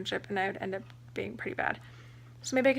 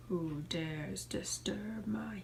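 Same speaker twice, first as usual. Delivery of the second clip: slow, whispered, rasping lower voice; ancient rasping undead king; 3 semitones lower, 9 dB quieter, much slower than usual. Pretend. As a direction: rasp it out like an ancient sleeping king. Voicing the sleeping cat.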